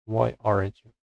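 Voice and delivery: ordinary talk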